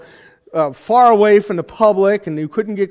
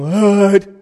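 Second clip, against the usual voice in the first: put-on eye-rolling teenager; 4 dB louder than usual. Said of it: "teenager rolling their eyes and going".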